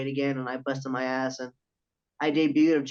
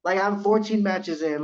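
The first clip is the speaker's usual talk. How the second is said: usual speaking voice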